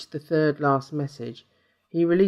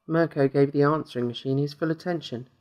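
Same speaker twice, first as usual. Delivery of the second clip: ordinary delivery, nothing marked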